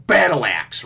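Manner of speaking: shouted move name, loud; attack call. Retold or imitated. Luffy smashing the deck, loud shouted attack name. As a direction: bellow it as the blow lands.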